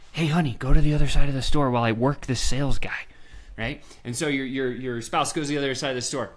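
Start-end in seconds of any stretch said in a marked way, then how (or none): none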